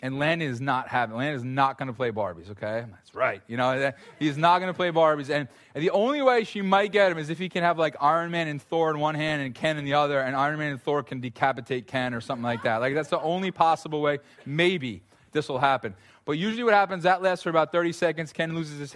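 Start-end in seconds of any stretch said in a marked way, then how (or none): none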